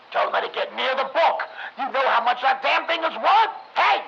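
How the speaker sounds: loud, high barked orders; angry yell. Acted. Rothman ordering them to protect the valuable book, angry yell.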